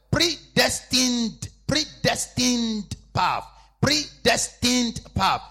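slow, loud, each syllable of "predestined" drawn out; drawn out "Predestined path" at full volume; stress on predestined. Shown as a demonstration. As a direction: hammer "predestined" so they remember it. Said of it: "don't forget that word".

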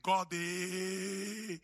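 put-on African-accented exclamation with a drawn-out ending. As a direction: let the African accent slip out. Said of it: "when my African accent came out".

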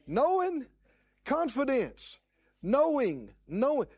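high, loud hammering of knowing; emphatic preaching burst. Real, not acted. Preacher hammering the word knowing to the congregation. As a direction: punch each word from the pulpit.

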